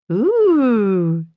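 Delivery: high, drawn-out "ooh" of anticipation; excited exclamation. A narrator's reading. Faith to her friends, eager to hear a secret, excited exclamation. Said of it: "Faith exclaimed".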